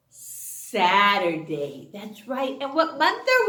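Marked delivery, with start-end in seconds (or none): 0.1-0.7 "s-" hissed with no voice, a hint; whispered s sound. Shown as a demonstration. Teacher hints the first sound of Saturday for kids. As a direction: breathe the s to cue Saturday. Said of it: "Tomorrow is s".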